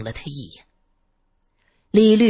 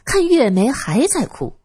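ordinary delivery, nothing marked

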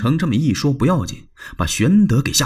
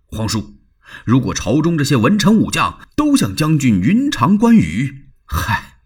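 impassioned Dong Cheng; 4 semitones higher than usual. Acted. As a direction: proclaim with fervour. Dong Cheng voiced in story. (慷慨激昂的国舅)

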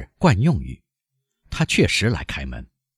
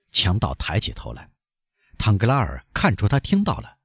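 usual speaking voice

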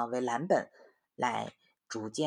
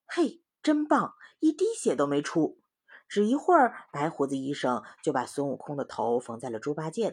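story reading; 6 semitones higher, 5 dB louder than usual. A narrator's reading. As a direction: lively voice reading to children. Mother reads story to children. (给孩子讲故事的生动语气)

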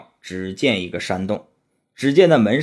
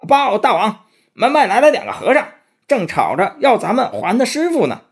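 high, hurried servant-demon report; squeaky little goblin rushing in with news; 11 semitones higher, 3 dB louder than usual. Acted. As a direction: burst in breathless to tell the king. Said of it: "急急忙忙跑进来".

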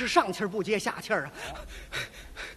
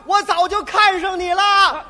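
loud high shout, slow and drawn out; girl shouting after the cyclist; angry shout; 7 semitones higher, 8 dB louder than usual. Acted. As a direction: yell it at him in a temper. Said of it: "这姑娘一听这气儿就大了".

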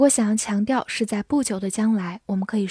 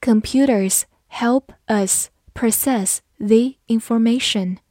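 careful teacher pace with gaps between words; measured, spaced-out reading for learners; slow model reading. Shown as a demonstration. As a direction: read slowly word by word. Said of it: "好，慢慢来读".

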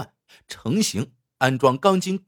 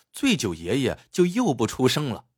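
ordinary delivery

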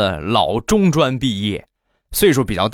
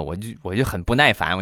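ordinary talk

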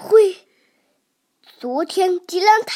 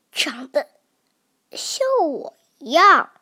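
whisper. Acted. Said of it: "T-rex whispers to himself".

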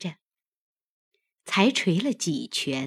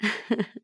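short, soft, scornful chuckle; cold laugh; 4 semitones higher, 6 dB quieter than usual. A narrator's reading. Ailing mistress answers her maid with a cold laugh. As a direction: give a brief cold little laugh. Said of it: "凤姐冷笑道".

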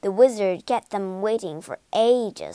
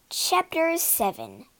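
ordinary delivery

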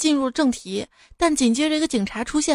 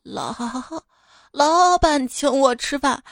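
put-on slow, stammering drunken retort; angry drunk; 2 semitones higher, slower than usual. Drunk husband answering his complaining wife, angry slurred stammer. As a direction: drag and stumble over the words, acting drunk and indignant. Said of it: "广禄生气地说".

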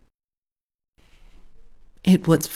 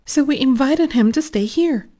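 mom's cheerful plan; 9 semitones higher than usual. Acted. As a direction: announce the houseguest brightly. Mom announces the plan.

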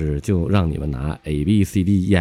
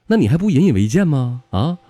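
lightly voiced scolding mum, pitched up; higher, exasperated questions from a mother; 7 semitones higher than usual. Mum scolds her child over the confiscated phone, exasperated.

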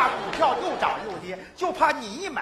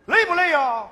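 loud, higher mock-sweet boss; mock boss asking; 7 semitones higher, 9 dB louder than usual. Third boss asks about tiredness in a high, loud, sweet voice. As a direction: sing it out like a fake-kind boss. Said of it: "那个老板又问我".

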